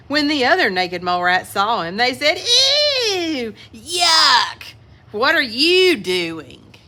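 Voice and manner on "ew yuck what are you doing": high squealing ew, then slow; disgusted. A narrator's reading. Mole rats recoiling at Wilbur's clothes, disgusted.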